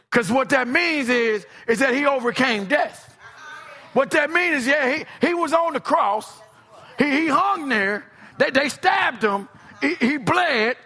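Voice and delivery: high-pitched, driving sermon delivery; fervent preacher hammering each point; impassioned preaching. Real, not acted. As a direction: preach it with rising fervor, phrase by phrase. Preaches the crucifixion at full force.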